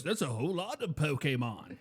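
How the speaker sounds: a hint of a cartoon doctor; lightly imitated character line, "kay" in Pokemon. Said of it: "the doctor in Pokemon says that".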